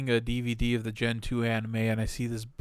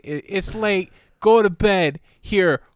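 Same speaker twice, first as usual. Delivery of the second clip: loud, higher-pitched parental order; mock bedtime mom; 6 semitones higher, 4 dB louder than usual. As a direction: send the kid to bed, voice raised. Voicing own mom at bedtime.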